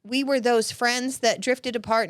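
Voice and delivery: ordinary talk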